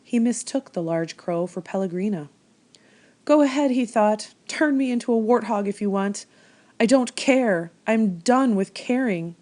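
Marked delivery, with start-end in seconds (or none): 3.2-9.4 defiant. A narrator's reading. Edward defying the crow.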